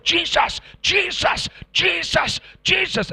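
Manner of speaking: high, loud repeated calls; shouting. Retold or imitated. Preacher re-enacts advancing on the gunman, shouting. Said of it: "and I shouted".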